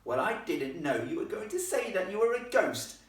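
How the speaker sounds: high, loud indignant protest; indignant high-pitched objection; protesting girl. Acted. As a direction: protest back as an affronted girl. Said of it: "protested the girl".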